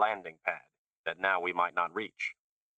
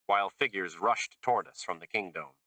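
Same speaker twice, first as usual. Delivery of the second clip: ordinary delivery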